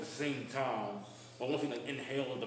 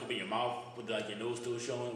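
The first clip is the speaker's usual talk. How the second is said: ordinary talk, nothing marked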